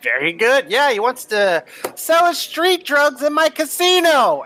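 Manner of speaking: mock outrage. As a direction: sputter in disbelief at the nerve. Angry character about drug dealer.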